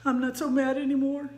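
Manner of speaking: a hint of a kid letting the anger go; calmed-down child. Niece telling her daddy she's done, lightly imitated child voice. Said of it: "I remember hearing my niece saying".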